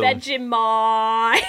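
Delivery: very drawn-out rising Aussie; Australian accent. Pretend. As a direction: stretch it wide in mock Australian. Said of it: "An Australian accent?".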